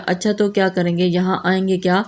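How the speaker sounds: ordinary delivery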